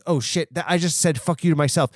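normal talking voice

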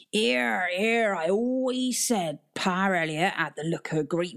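rough West Country sailor, laughing; swaying drunk old sea dog; drunken old sailor. Acted. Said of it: "the old sailor folded over with laughter".

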